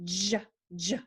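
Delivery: buzzy repeated "j, j"; voiced J. Shown as a demonstration. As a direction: say "j, j" as voiced bursts. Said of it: "you turn your voice on".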